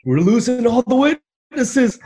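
high, loud, half-joking cry of alarm; hammed-up plea to stop everyone leaving; mock panicked yell. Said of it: "I'm like".

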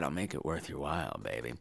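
very soft seductive aside; sultry whisper. Acted. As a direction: purr it low.

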